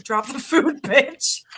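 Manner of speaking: voicing the dog with a bossy bark. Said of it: "pretend that her dog Gatsby can talk".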